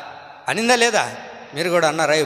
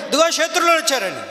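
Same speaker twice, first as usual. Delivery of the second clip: alarmed shout; 12 semitones higher than usual. Acted. Delilah cries enemies are coming.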